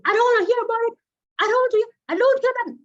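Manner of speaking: high, loud mock yell of refusal; exaggerated shrieking parent who won't listen; imitated mother shouting. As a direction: shout it high and fast, as a child mimicking mom. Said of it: "You keep shouting".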